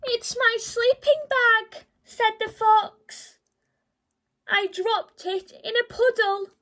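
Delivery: sobbing little fox; crying fox. Acted. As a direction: wail it through tears. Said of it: "who was crying".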